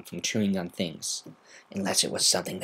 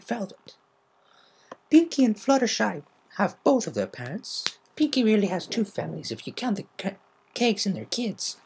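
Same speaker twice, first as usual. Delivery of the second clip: Applejack voiced; 11 semitones higher than usual.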